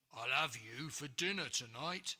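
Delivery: a hammed-up greedy ogre; hungry ogre. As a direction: gloat over your dinner. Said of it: "said the ogre".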